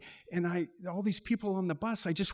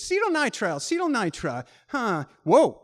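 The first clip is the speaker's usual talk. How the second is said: much higher, louder startled reading; alarmed lookup; 5 semitones higher, 7 dB louder than usual. Retold or imitated. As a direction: mutter the word, then gasp in alarm. Operator looking it up, alarmed.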